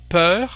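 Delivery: Peur with an open rounded "uh", loud and rising; open French eu. Shown as a demonstration. Teacher asking learners to hear the difference, open French eu. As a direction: say Peur with the looser, open vowel. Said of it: "Can you hear the difference?".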